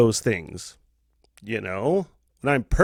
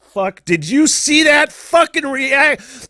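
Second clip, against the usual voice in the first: much higher and louder, slow and stretched out; excited brag; 10 semitones higher, 4 dB louder, slower than usual. Retold or imitated. Gamer hyping his own fast reaction to a friend, excited. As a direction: crow over a great reaction. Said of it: "I was like".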